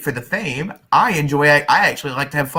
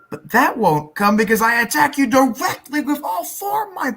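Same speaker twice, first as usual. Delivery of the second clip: hammed-up attack call; 9 semitones higher than usual. Hammy duel attack.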